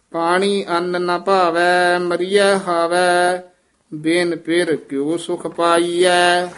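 measured, drawn-out devotional chant; scripture recitation. Shown as a demonstration. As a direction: recite steadily, holding the line endings. (ਠਹਿਰਾਅ ਵਾਲਾ ਗੁਰਬਾਣੀ ਪਾਠ)

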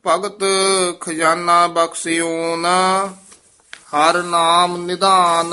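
measured chant, held line endings; scripture recitation. Acted. Teacher leads students through Gurbani, devotional recitation. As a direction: recite the verse slowly on a near-level note.

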